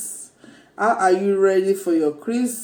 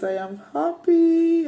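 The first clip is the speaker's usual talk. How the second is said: much higher, excited squeal; squealing happy; 10 semitones higher than usual. Real, not acted. Vlogger thrilled after unboxing her laptop, high squeal. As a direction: let the joy jump up into a squeal.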